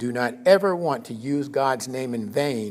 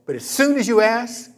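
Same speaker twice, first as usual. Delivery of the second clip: normal talking voice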